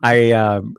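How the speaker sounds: normal talking voice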